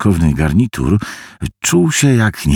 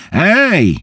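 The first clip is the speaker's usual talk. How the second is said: sharp call into the phone, much higher; shout; 12 semitones higher than usual. Acted. Harvey shouts into the receiver, alarmed. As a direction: call out to get his attention. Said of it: "krzyknął Harvey do słuchawki".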